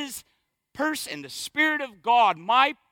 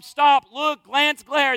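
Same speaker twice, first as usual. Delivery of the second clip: punchy commands; 3 semitones higher, 6 dB louder, slower than usual. Shown as a demonstration. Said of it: "I hit those beholds hard every week".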